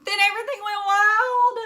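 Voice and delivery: high, loud excited outburst; big exclaiming storyteller; wild burst. A narrator's reading. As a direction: explode into the chaos. Chaos erupts.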